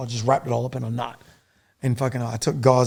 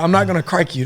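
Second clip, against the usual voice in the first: firm refusal; 5 semitones higher, 4 dB louder than usual. Retold or imitated. Refusing wounded medic.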